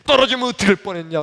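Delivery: fast, loud, high rebuke; acted angry outburst; angry scolding. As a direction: snap out a father's scolding. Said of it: "얼마나 야단을 치는지".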